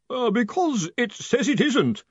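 high, slow quavering voice. A narrator's reading. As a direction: quaver with nerves. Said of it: "Collarbone quavered".

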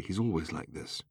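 soft, resigned sadness; sad insistence. A narrator's reading. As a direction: insist quietly and sadly. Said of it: "insisted Arthur sadly".

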